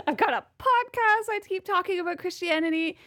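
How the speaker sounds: very high, comic self-exasperation; mock protest. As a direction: squeak out a hammed-up complaint about yourself.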